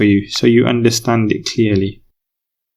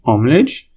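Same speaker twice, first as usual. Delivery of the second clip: "Omlej" with the pitch climbing to the end; question intonation. Shown as a demonstration. Teacher showing how to ask for clarification, question intonation. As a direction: say "Omlej" as a one-word rising question. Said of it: "in a question intonation".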